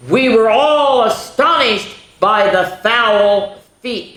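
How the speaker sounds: "foul" stretched slow and high; slow emphatic read. Shown as a demonstration. Teacher reading the pun line to the class slowly. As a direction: lean hard on "foul", drawn out. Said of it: "birds are called fowls".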